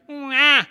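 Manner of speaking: loud, high mock wail; imitated baby cry. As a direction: mimic a bawling baby in one burst. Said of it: "She cries".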